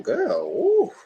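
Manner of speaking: high squeaky mock-shocked "girl, ooh"; playful high squeal of surprise. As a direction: pitch way up in fake disbelief. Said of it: "I said".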